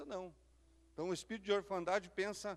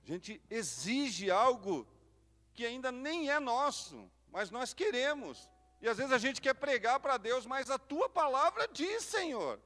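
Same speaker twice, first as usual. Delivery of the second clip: louder, slow fervent preaching; 6 semitones higher, 6 dB louder than usual. Acted. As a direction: drive it home to the congregation. Pregação em força total. (pregação fervorosa, mais alta e lenta)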